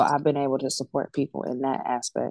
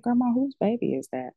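higher-pitched, retold bewildered question; puzzled question; 9 semitones higher than usual. Granddaughter asks grandma's spirit whose baby that is, puzzled. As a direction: ask it as if baffled, voice lifted.

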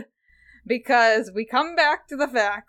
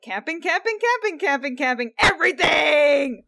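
exasperated build; 6 semitones higher, 5 dB louder than usual. Retold or imitated. Mocking repetitive plot.

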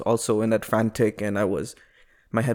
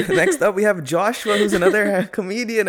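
a higher, laughing emcee intro; mock MC announcement; 9 semitones higher than usual. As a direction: introduce the next act like a host, laughing. Mock announcing the next comedian.

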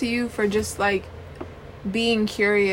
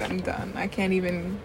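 normal talking voice

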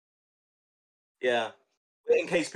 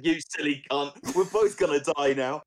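higher, louder, hammed-up indignant frog; mock furious frog; 5 semitones higher, 4 dB louder than usual. Frog swearing at the scorpion as they sink, mock furious and higher. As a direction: play the drowning frog cursing the scorpion in a comic rage. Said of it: "The frog said".

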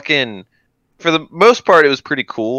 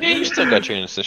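sarcastic mocking; 12 semitones higher, 3 dB quieter, faster than usual.

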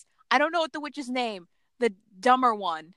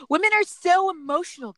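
higher, mocking male gripe; mock man voice; 9 semitones higher, 4 dB louder than usual. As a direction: lightly sneer like a dismissive man. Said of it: "that's how a man would say it".

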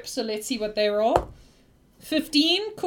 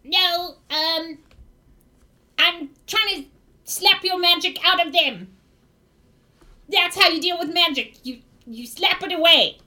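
higher-pitched, halting goblin voice; defiant goblin; 4 semitones higher than usual. Acted. Goblin justifies slapping his friends, higher goblin voice. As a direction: goblin explaining himself with odd conviction. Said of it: "He's gonna say".

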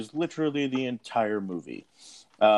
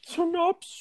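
squeaky high voice. Pretend. Comic repeat of the word.

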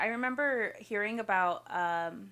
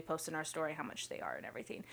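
whispering; 3 semitones lower, 8 dB quieter, faster than usual. Real, not acted. Aside about posting prices.

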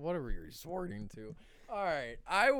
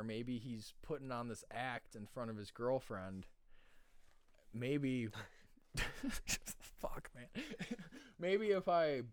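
ordinary talk

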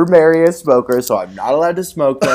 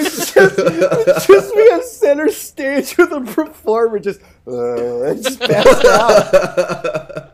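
high, giggling through words; laughing; 9 semitones higher, slower than usual. Pretend. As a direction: laugh while describing it. Imagined cabaret scene, laughing.

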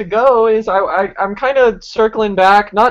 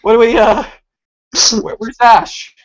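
confused character voice; 3 semitones higher than usual. Acted.

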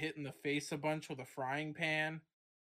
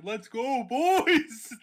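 hype shout; 6 semitones higher, 9 dB louder, much slower than usual. Acted. Hyping the crowd.

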